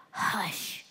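hushed, breathy command; calm the sea with a whisper; soft, still rebuke. Acted. Jesus calms the storm.

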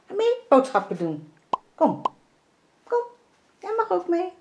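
high, cooing pet voice; talking to the dog. Real, not acted. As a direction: tell the dog the happy news. (hoge koerende hondenstem)

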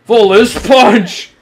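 slow drawn-out attack shout; battle cry. Real, not acted. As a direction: stretch the move name into a yell. Shouting his signature attack again.

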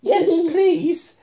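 loud, high yes please; little girl's excited high reply; high eager little voice. Acted. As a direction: squeak it out eagerly. Miss Tiny voiced high.

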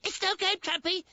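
high, urgent protest; alarmed insistence. Acted. Insisting the Indians are real.

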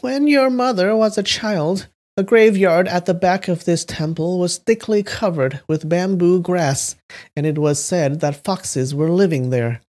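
put-on high old granny voice telling a tale; an old grandmother recalling the past. Shown as a demonstration. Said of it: "Should I do a grandmother voice?".

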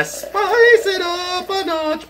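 high, slow, drawn-out shout; hammed-up hype. Real, not acted. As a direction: ham it up like a TV chef. Hype while mixing.

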